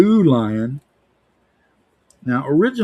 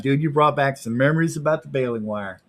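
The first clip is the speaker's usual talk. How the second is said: ordinary delivery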